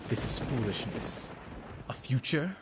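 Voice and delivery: hushed disbelief with questioning rises; scornful villain. Acted. Villain scoffing at the king's wish, scornful. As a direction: softly question it with disdain.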